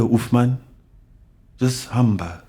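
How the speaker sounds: ordinary talk, nothing marked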